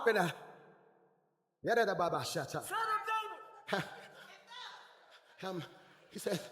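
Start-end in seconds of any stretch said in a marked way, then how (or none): none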